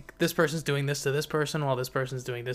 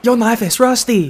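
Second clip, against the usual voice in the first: much higher and louder mock Chinese accent; Chinese accent attempt; 10 semitones higher, 16 dB louder than usual. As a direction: copy the bad Chinese accent, loudly. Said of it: "adding a Chinese accent".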